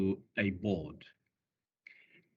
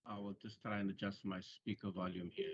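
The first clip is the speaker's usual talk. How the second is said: barely audible; 3 semitones lower, 10 dB quieter, faster than usual. Real, not acted. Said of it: "Sir, your voice is very faint".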